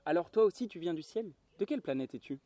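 a hint of an eager little questioner; curious little prince. A narrator's reading. Little prince asks the pilot about his origins, curious.

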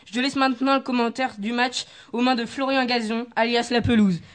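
loud broadcast welcome for the listeners; announcer. Acted. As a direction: open the show like a sports channel host. Opens the match broadcast. (ton d'animateur télé, fort)

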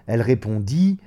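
usual speaking voice

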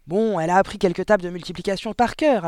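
gruffly dismissive headmistress; barking. A narrator's reading. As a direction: bark it out impatiently. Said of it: "aboya mademoiselle Gourdin".

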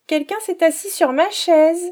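little cub wailing that it's all gone; squeaky baby bear upset; tiny bear's little voice. A narrator's reading. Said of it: "dit le tout petit ours de sa toute petite voix".

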